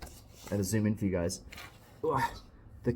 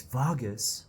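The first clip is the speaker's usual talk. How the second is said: ordinary talk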